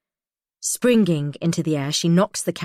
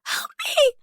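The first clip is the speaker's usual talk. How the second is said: high, desperate cry for help; terrified scream. A narrator's reading. Drugged prisoner screams for help, terrified. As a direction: scream it out in panic. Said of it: "I scream".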